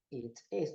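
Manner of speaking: "It is" with short i leaning to e; low, careful "It is", almost "et es"; native-like pronunciation. Shown as a demonstration. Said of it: "trocando o I pelo E".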